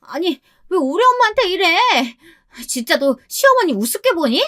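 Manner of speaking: very high indignant outburst; acted tantrum, pitch soaring; screeching sister-in-law. A narrator's reading. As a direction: shriek in protest for your mother. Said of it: "악까지 바락바락 썼습니다".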